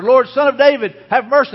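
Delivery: high, loud plea from the blind men; preacher voicing an insistent shouted appeal. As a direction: cry out over the crowd, imitating their shouts. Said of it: "they only shouted louder".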